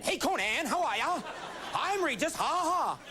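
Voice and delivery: high, hammed-up host greeting with fake laugh; Regis Philbin. Regis greeting Conan, hammed-up high impression. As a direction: lame high-pitched talk-show host impression. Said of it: "that lame Regis impression".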